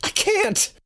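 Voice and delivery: very high, helpless cry; panicked refusal. Acted. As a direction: squeal it in panic. Panicked refusal to throw the snake.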